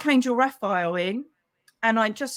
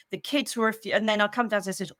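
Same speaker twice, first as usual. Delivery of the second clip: normal talking voice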